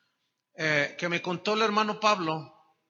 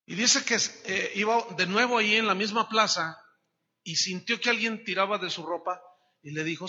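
ordinary talk

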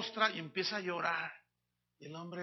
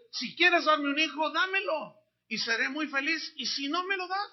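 más agudo y fuerte, con fervor; oración ferviente; 8 semitones higher, 6 dB louder than usual. Retold or imitated. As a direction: clama a Dios con entusiasmo. Ana ora con fervor. (Ana le habla a Dios con fervor, agudo y fuerte)

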